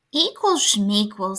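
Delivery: slow, drawn-out scoff; dismissive bear. A narrator's reading. Bear scoffing at Mouse's talk of equal teams, dismissive. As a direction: stretch it out, brushing the idea off.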